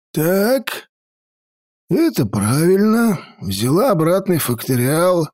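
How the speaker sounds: put-on old mage voice. A narrator's reading. Old mage checks work.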